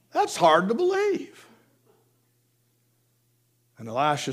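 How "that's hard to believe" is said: doubtful servant. Acted. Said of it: "the servant looks around and said".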